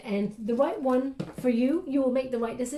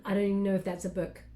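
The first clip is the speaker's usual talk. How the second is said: ordinary talk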